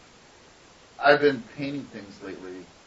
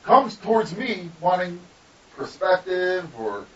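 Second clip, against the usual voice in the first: retold rant, raised and drawn out; telling her off; 7 semitones higher, 9 dB louder than usual. Student to intern teacher who graded them, telling her off. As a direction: lay out the grievance with rising indignation. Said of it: "did I tell her off".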